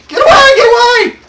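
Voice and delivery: very high, loud panicked shriek; frantic yelling. A narrator's reading. Story reader voices panicking guards swinging arms, frantic yelling. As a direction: yell wildly to drive it off. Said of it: "in the air, yelling".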